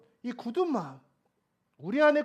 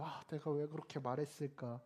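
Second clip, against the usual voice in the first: soft, low self-reproach; tearful regret; 6 semitones lower, 9 dB quieter than usual. Retold or imitated. Father regretting his outburst while praying for his child, tearful regret. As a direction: voice a father's hushed remorse. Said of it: "막 눈물이 흘려요".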